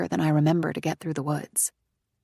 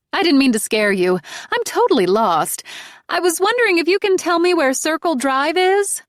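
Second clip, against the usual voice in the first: higher, loud voice over rain; lost driver calling out; 11 semitones higher, 6 dB louder than usual. Acted. Lost woman asks the narrator directions, calling loudly. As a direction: shout politely through the rain for directions. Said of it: "she says".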